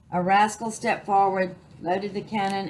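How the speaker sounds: ordinary delivery, nothing marked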